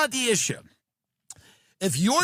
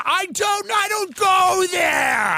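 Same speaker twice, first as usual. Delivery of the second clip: mock shout; 9 semitones higher, slower than usual. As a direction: blurt it in panic. Comic outburst answering critic.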